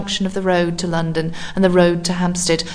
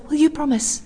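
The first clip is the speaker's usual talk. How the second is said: higher, pleading repeat; pleading fear; 7 semitones higher than usual. A narrator's reading. Woman pleading again with the stranger, fearful. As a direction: beg him once more, fearful. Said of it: "with a pleading fear and confusion".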